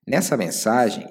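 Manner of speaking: ordinary talk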